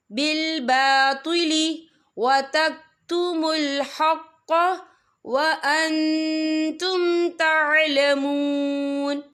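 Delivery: slow, drawn-out tajweed chant; Quran recitation. Shown as a demonstration. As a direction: recite it slowly and melodiously. (धीमी तिलावत)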